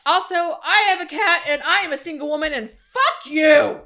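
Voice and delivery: high, loud mock indignant outburst. Real, not acted. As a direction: fire back in mock outrage.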